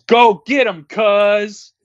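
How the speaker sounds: high, loud hammy holler; imitated movie yell. Girl kicking the chainsaw over to Leatherface, mock heroic yell. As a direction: yell it out like a cheesy action line. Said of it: "and she yells".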